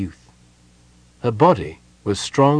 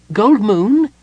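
high, loud character voice; kender calling; 12 semitones higher, 7 dB louder than usual. A narrator's reading. Tass tries the closed door once more, calling. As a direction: call the name high and loud. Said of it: "Tass began".

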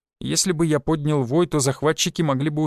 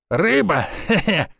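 gleeful call with a chuckle after; triumphant cry; 3 semitones higher, slower than usual. A narrator's reading. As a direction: call out a winning move, then chuckle. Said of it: "выкрикнул мускулистый мужик". (торжествующий выкрик со смешком)

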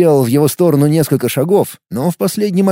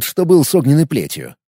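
usual speaking voice

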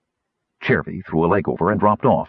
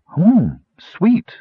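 high, louder savoring exclamation; delighted discovery; 11 semitones higher, 5 dB louder than usual. A narrator's reading. Chervy tasting raisins first time, pleasantly surprised. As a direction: pitch it up high, tasting something new.

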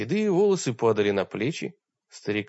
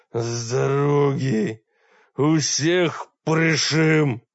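slow, dragged-out rasping shout, a little louder; hoarse rasp; 4 dB louder, much slower than usual. Acted. Wounded warrior rasping at soldiers to clear the way. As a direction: force each word out through a raw throat. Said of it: "Прохрипел".

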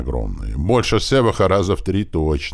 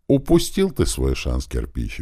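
ordinary delivery

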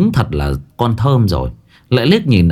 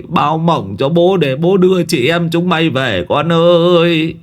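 put-on grief-stricken old man crying out to them; wailing lament; 8 semitones higher than usual. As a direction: mourn the children as if they were dead. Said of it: "về phía con cháu mà khóc".

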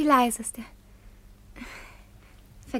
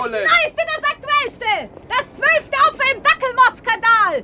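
calling out; 11 semitones higher, 6 dB louder than usual. Acted. Rival paper seller hawking.

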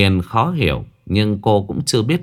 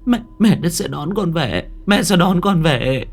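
tearful promise; 8 semitones higher than usual. Acted. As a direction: promise through tears. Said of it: "xúc động mếu máo".

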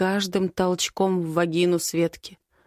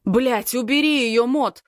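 yelled high and harsh at the brother; furious shout; 5 semitones higher, 4 dB louder than usual. Acted. Caught in the act, Den yells at Mot to take her away: furious shout. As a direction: shout him off, raging. Den yelling during the fight.

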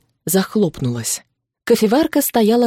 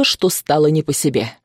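ordinary delivery

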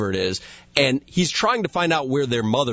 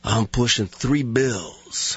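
slow, deadpan tough-guy brag; unhurried, self-serious cop voice; Steven Seagal impression; slower than usual. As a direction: answer in character, lazy and slow. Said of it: "your Steven Seagal impersonation".

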